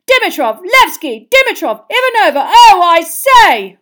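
loud, high, building excited roar; rising excitement in each name. A narrator's reading. Bagman roars the Bulgarian passes to the stadium. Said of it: "roared Bagman".

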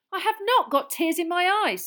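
loud, high, indignant denial; Harry snaps back hard, raising his voice; loud protest. A narrator's reading. Harry to the prying reporter, loud indignant protest. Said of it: "said Harry loudly".

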